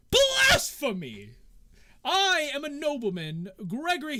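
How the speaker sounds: high, loud, stretched-out protest; pompous noble crying out in outrage; indignant outburst. Acted. Nobleman refused entry, protests.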